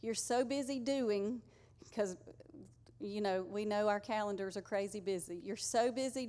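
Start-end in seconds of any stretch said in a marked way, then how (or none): none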